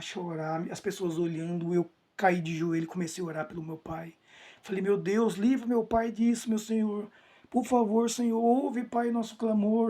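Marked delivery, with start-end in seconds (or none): 4.8-9.9 retold plea, raised pitch, drawn out; pleading prayer. Son on his knees praying for his father, retold pleading. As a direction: re-enact crying out to God.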